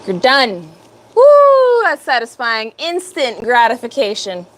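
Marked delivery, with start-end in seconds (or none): 1.1-1.8 high, loud drawn-out woo; real whoop of satisfaction; excited whoop. Real, not acted. Streamer to viewers at the satisfying part, excited whoop. Delighted at long-awaited satisfying paint job.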